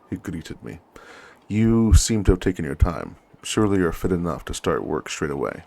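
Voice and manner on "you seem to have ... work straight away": loud superior officer, lightly acted; condescending lieutenant. Lieutenant chides the newly arrived officer, mildly acted condescension. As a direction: talk down to a tardy junior.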